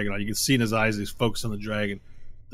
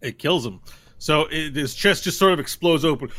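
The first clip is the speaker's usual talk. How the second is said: usual speaking voice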